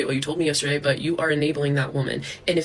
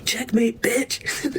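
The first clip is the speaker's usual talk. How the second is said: gloating; 7 semitones higher, much slower than usual. Real, not acted. Gloating at the trolls.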